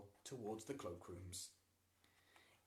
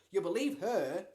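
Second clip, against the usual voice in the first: loud, resentful bitterness on believe; bitterly; 7 semitones higher, 13 dB louder than usual. A narrator's reading. Boy resenting his mother trusting his stepsister, bitter. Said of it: "Lloyd said bitterly".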